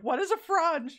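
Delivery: high, loud incredulous question; mock bafflement. Reacting to friend Jen's frunch room, baffled. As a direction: question the word as if it's absurd.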